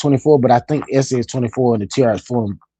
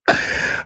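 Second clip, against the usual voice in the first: breathy laugh; 7 dB quieter than usual. Real, not acted. Laughs at chat.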